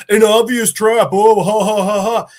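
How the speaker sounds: hammed-up gloating announcement; mocking AI. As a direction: imitate a taunting system voice, ending in a staged laugh. Mocking AI voiced with laugh.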